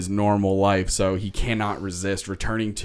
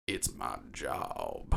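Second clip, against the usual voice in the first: much lower, softer and slow, dead flat delivery; deadpan rat guard; 10 semitones lower, 12 dB quieter, much slower than usual. Retold or imitated. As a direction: drop the voice right down and drag it out.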